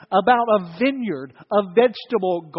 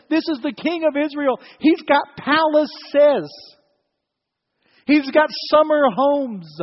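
preaching at full force; 6 semitones higher, 5 dB louder than usual. Acted. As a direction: build the indignation over his palaces. Preacher building to emphatic climax.

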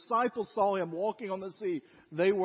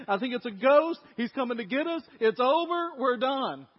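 panicked disciples; 4 semitones higher, 6 dB louder than usual. Acted.

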